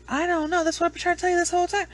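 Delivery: high, fast protest; mock exasperated son. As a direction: blurt it out as a fed-up kid. Imitating exasperated son answering mom.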